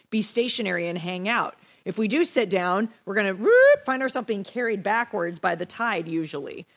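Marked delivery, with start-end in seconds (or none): 3.3-3.9 quick rising whoop, loud; a louder, sliding "whoop". Shown as a demonstration. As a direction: sound effect of being swept away. Imitates being swept backwards.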